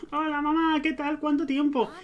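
high-pitched hello to mother; high, chirpy pretend greeting; greeting mom in character.

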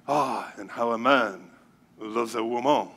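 put-on low, soft, slow French-lover accent. Preacher reading the verse on love, mock French accent. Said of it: "say it with a French accent".

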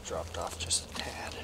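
hushed aside after the shot; whispering. Real, not acted. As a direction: barely whisper it.

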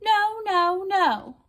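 very high squeaky puppy voice; yappy panting puppy. Acted. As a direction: squeak it like a stubborn pup. Puppy refuses the dogs in story.

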